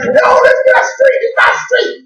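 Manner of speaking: high, thrilled shout of recognition; joyful high-pitched calling out; excited cry. Acted. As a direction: yell it out, overjoyed to know the street. Healed blind man recognising home.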